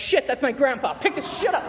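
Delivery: high, loud alarmed blurt; sudden panic, hurry the friends; retold panic. Realizing grandpa is coming, high loud panicked. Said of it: "And I'm like".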